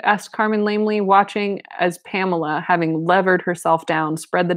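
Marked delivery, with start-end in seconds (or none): none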